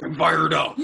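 mock battle cry from a fighting game; Mortal Kombat fighter. Joking that the pup sounds ready for combat, mock fighter shout. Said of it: "like, a Mortal Kombat thing".

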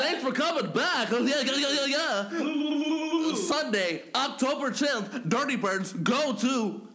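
high, loud, hyped host voice; show outro. Acted. Hosts signing off the show to listeners, loud and hyped. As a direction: hype the outro and promo with energy.

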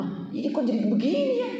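high-pitched puzzled question; retold bewilderment. Preacher questioning own reaction afterwards, puzzled. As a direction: rise sharply in disbelief.

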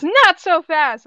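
high, loud, drawn-out warning; commanding call. Acted. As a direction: call out to stop them. Character stops the runner.

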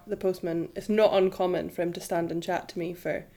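ordinary talk

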